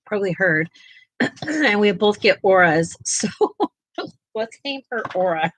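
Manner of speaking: normal talking voice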